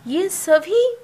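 loud teacher emphasis; emphatic call. Real, not acted. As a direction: raise your voice on ये सभी to the class.

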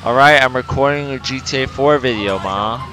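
loud call to another room. Real, not acted. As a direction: shout it to someone far off. Calling out to mom.

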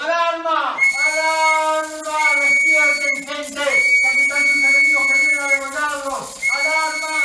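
high, sustained alarm screams; crazed shouting. Acted. Captive warning sleepers of armed attackers, crazed shouting. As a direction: scream to wake the whole village. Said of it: "empecé a gritar como loco".

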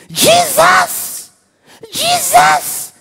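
high, loud, straining cry of the name; retold frantic shout. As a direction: imitate a terrified interpreter yelling for Jesus. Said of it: "the man is shouting".

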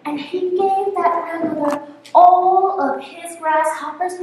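ordinary delivery

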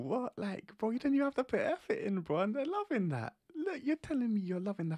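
amazed outcry; incredulous. Real, not acted. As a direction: exclaim in disbelief. Incredulous at girls' preferences.

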